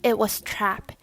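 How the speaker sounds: usual speaking voice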